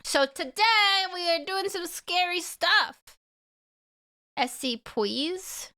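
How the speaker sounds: mock spooky voice. Spooky teasing intro.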